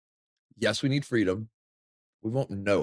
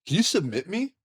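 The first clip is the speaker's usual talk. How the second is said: pleading demand; 8 semitones higher, faster than usual. Acted. Voicing dog sizing up newcomer.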